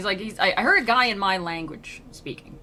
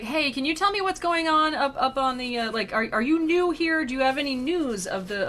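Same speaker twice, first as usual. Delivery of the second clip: higher-pitched, eager mock questioning; 6 semitones higher than usual. Spirit asks a newcomer for news from home, eager questioning. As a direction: imitate a spirit pestering for news.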